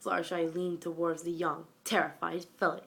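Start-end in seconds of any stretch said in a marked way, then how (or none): none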